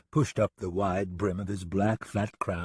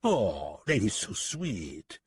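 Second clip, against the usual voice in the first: slow, mock-sweet French accent; darkly mocking; much slower than usual. French gunman mocks deputy's marriage savings, darkly sweet. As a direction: drawl it out with a dark grin. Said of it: "said Lipov, grinning darkly".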